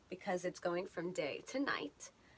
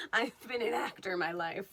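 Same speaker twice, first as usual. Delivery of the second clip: usual speaking voice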